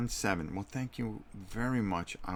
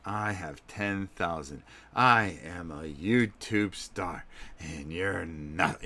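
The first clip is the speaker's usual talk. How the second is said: slow, drawn-out menacing taunt; imitating his own creepy villain act; vicious creepy voice; slower than usual. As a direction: gloat slowly, spacing out each word. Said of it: "I go into this vicious thing".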